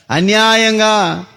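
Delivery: slow, stretched cry of injustice; drawn-out wail. Acted. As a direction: drag the word out like a lament. Abel's blood cries to God. (అన్యాయంగా అని నెమ్మదిగా సాగదీసిన ఆర్తనాదం)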